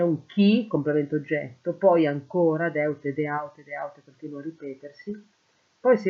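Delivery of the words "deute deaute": ordinary delivery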